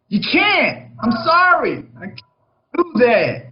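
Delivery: "can't" up high, then a slow, pleading "I'm sorry, I can't do that"; helpless inability. Shown as a demonstration. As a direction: sound unable, not unwilling. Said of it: "expresses an inability to, to perform".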